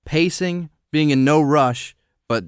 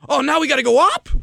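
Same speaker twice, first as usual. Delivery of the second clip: very high, loud panicky question; mock freak-out; 5 dB louder, faster than usual. Imitating a nervous passenger facing another hill, freaking out. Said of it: "He'd be, like, freaking out".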